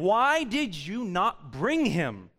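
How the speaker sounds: slow, weighed restating of the question; preacher repeating the Pharisees' demand slowly; slow reread. Acted. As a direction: lay each word out slowly.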